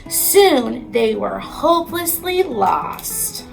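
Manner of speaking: usual speaking voice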